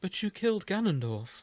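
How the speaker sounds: Zelda, puzzled, pointing out. Acted. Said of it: "she points out".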